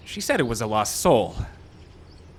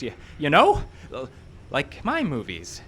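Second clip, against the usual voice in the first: ordinary talk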